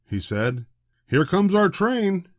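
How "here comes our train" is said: excited announcement. A narrator's reading. Man announces train.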